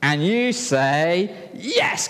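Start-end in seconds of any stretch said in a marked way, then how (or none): 1.5-2.1 very high, bright yes; eager yes. Acted. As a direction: chirp it eagerly. Voicing second son.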